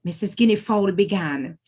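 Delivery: ordinary delivery, nothing marked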